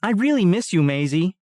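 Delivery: put-on a small boy missing his sister; young boy's voice. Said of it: "then he says".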